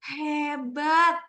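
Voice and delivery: drawn-out, high, loud praise word; slowed at comma. Shown as a demonstration. Teacher showing the pause at a comma, "Hebat" slowed and lifted. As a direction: stretch HEBAT before the comma. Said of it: "kita bisa lambatin".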